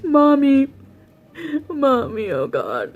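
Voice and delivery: mimicking a drunk man sobbing for his mom; girl imitating her date's drunken crying for his mommy; mock crying. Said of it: "he starts crying and saying".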